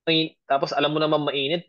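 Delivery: ordinary delivery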